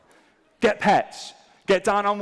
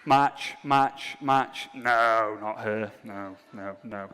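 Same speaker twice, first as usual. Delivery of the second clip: low, unimpressed dismissals; deadpan swiping; 7 semitones lower, much slower than usual. Acted. Swiping through strangers on a dating app, bored dismissal.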